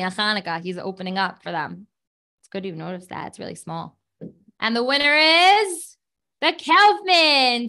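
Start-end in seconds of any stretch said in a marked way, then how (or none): 4.6-7.7 louder, higher, slow winner reveal; booming prize announcer. Acted. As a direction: drag out the name like a host.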